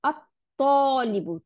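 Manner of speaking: "আত-তলিবু" very slowly, stopping on the T; slow model of "আত-তলিবু", no L sound; doubled T. Shown as a demonstration. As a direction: hold the T closed in "আত-তলিবু", then stretch the vowel. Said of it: "দুইটা ত হয়ে গেল".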